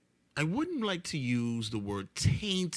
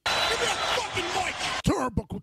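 shouting; 4 dB louder than usual. Acted. Podcast intro, shouted opener.

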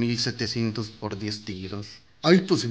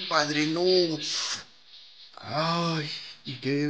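ordinary talk, nothing marked

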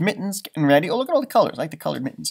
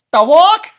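much higher and louder, bright call; eager suggestion; 12 semitones higher, 11 dB louder than usual. Acted. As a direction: pipe up excitedly. Character suggests a sledding place.

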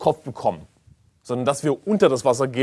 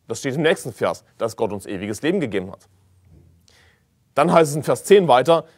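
usual speaking voice

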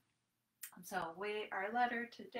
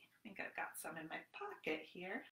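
whisper; 3 semitones lower, 8 dB quieter than usual. Acted. Secretive pocket search.

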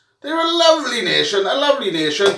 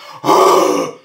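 loud, rough breathed-out roar; dragon fire breath; 5 dB louder than usual. Acted. Fan acting the Welsh dragon breathing fire on the Scots, roaring breath. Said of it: "he's gonna breathe".

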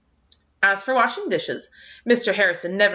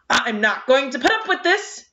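higher, sputtering fury; spluttering anger; 6 semitones higher than usual. A narrator's reading. Angry neighbour to the girl, spluttering high. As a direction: splutter it out in a rage. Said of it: "he spluttered".

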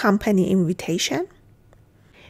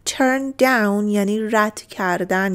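ordinary talk

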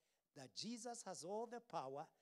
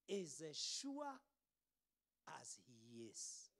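ordinary talk

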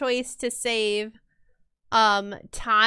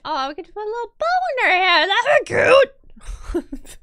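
higher, fast cutesy gushing; 9 semitones higher, 5 dB louder than usual. Real, not acted. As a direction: coo over the cute bow idea. Gushing over a cute idea.

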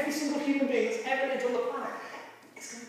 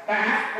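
a quick louder "ah" bleat; sheep bleat demo; 6 dB louder than usual. Shown as a demonstration. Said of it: "give your best sheep noise".

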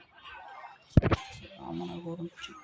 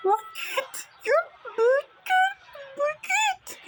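climbing, shrieking "git"; frantic screaming; 4 dB louder, much slower than usual. Real, not acted. Gamer screaming at the enemy to go away, rising shriek. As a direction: shriek it higher each time.

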